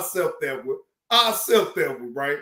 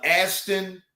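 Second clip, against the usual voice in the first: ordinary talk, nothing marked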